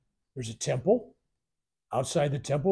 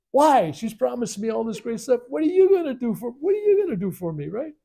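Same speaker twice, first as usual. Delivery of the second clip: indignant protest; 9 semitones higher, 6 dB louder than usual. Retold or imitated. Arguing back at the guru.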